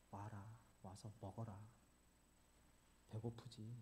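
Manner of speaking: soft, low, tender call; gentle invitation. Pretend. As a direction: speak softly like a loving host. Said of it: "예수님이 하신 말씀이 뭐예요?". (베드로에게 부드럽게 부르시는 예수님)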